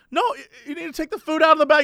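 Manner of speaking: lightly voiced hurried clerk; fast cashier lady. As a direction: rattle off the instruction quickly. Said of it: "She barely has an accent".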